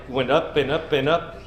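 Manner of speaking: usual speaking voice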